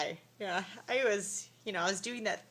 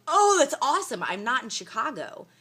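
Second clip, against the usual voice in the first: loud, high sarcastic cheer, then dropping; hammed-up sarcastic reply; mock enthusiasm; 6 semitones higher, 10 dB louder than usual. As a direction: burst out with fake delight. Sarcastic retold reply to clerk.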